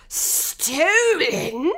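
loud shocked exclamation; exclaiming king. A narrator's reading. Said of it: "the king exclaimed".

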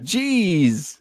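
lightly voiced folksy driver; folksy high voice. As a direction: act amazed at the coincidence.

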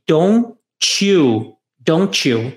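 "don't you" split apart and then joined as "dontchu"; linked don't you. Shown as a demonstration. Teacher showing students how "don't you" links into "dontchu". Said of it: "Olha a junção que faz aqui".

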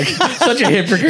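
laughing at himself. Real, not acted. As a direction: break into a laugh mid-line. Laughs at own hypocrisy.

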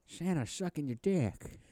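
high, slow drawn-out mock voice; joke movie impression. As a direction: draw it out high as a spoof film line. Joke line in film impression voice.

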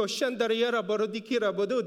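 murmured tongues syllables; praying in tongues. Shown as a demonstration. Pastor demonstrating prayer in tongues for his church. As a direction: rattle off tongues sounds. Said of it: "That's when we go".

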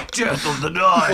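raspy growled band voice; metal band voice. Pretend. Repeats in metal voice.

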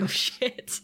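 breathy whispered aside; a quick whisper, caught in the lie; whispered oh shit. Retold or imitated. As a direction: mutter it under your breath, caught out. Spike realizes he's caught.

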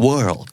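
WORLD with the middle R sounded; American-style WORLD, clear r-sound. Shown as a demonstration. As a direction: curl the R before the L in WORLD. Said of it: "มันจะมี R ที่อยู่กลางคำด้วย".

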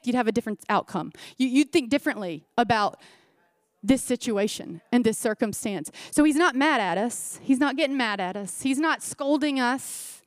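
ordinary talk

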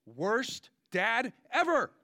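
indignant preacher's exclamation, slow; outraged verdict. Real, not acted. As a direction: drag out the disbelief, loud and high.

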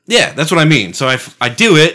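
ordinary talk, nothing marked

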